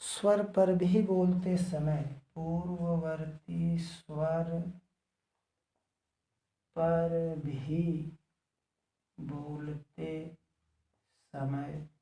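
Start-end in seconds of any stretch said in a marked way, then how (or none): 2.3-11.9 slow writing-along pace; slow dictation. Real, not acted. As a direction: dictate slowly with long pauses. Repeats it slowly for writing.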